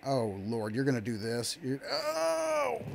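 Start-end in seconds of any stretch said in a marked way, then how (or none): 1.6-2.8 strained high yelps on the ride; under heavy g force. Real, not acted. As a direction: voice squeezed by the drop. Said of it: "You actually feel the G's".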